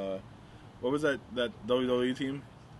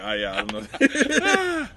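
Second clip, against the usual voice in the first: slow, high, clueless voice; 8 dB louder, much slower than usual. Retold or imitated. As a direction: play it dumb and slow. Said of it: "I literally was like".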